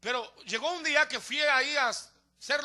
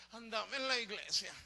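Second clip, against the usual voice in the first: small, soft, reluctant admission; barely-projected mumbled confession; sheepish answer; 3 semitones lower, 12 dB quieter than usual. Acted. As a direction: imitate someone timidly owning up. Acts out a sheepish churchgoer answering.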